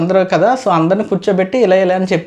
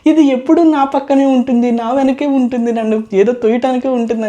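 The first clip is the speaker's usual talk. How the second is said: mock crying complaint; 5 semitones higher than usual. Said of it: "funny ఫన్నీగా చెప్పింది, ఏడిచింది".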